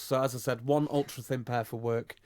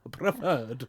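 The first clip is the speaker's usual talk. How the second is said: put-on old English professor. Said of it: "You said that like an old English professor".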